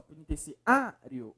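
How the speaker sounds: "Penitenciário" with the A before "rio" drawn out; stress on A. Shown as a demonstration. Teacher answering which syllable is strongest, stressed A. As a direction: lean slowly on the A of "Penitenciário". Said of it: "É o A, professor".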